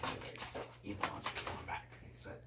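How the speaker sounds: usual speaking voice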